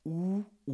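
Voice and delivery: slow "OU ou bien OU", lips rounded two different ways. Shown as a demonstration. Teacher shows two lip shapes for OU. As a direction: stretch "OU" out, once each way. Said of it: "de deux manières".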